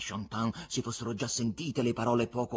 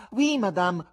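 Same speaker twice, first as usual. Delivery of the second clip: insolent buffoon; 10 semitones higher, 9 dB louder, slower than usual. Acted.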